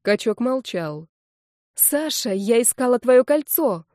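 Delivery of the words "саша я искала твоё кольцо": higher, raised, urgent appeal; crying out. A narrator's reading. Redhead appeals to Sasha about his ring, crying out. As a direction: call out desperately for a witness. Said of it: "выкрикнула рыжая".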